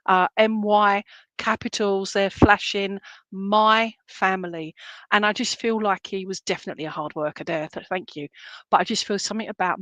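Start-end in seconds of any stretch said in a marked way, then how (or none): 3.3-4.7 slow, stretched "my" standing out before "family"; stress on MY. Retold or imitated. Medium repeats dad's flashing words, stress on "my". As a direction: spell it out in capitals with a long "my". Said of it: "can't emphasize the word my".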